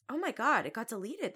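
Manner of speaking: ordinary delivery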